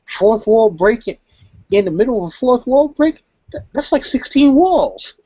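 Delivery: very high-pitched put-on character voice; Deadpool impression. Reviewer quoting the fourth-wall joke, high mock-amazed voice. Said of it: "and so he's like".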